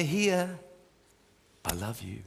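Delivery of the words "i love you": much softer, lower, tender hush; calming voice of God. Acted. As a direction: say it like God calming a frightened child. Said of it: "he has such a calming voice".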